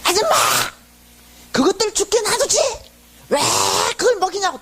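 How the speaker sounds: high-pitched imitation of a hostile vendor calling out; mock angry shout. Tape seller scolding the volunteer, mock angry shout. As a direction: imitate a man yelling a scolding. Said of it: "이러는 거야".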